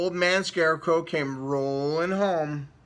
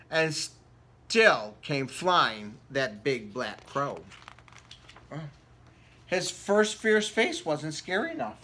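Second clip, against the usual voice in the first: plain reading voice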